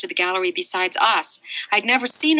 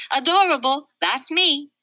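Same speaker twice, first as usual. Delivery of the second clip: put-on cute little kid; 7 semitones higher, 4 dB louder than usual. Fudge preening about himself.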